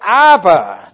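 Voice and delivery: a loud, hammering Aber; emphatic loud Aber. Shown as a demonstration. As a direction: slam down on the word Aber. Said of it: "dieses stärkere Wort für aber".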